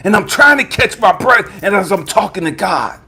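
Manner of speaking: ordinary talk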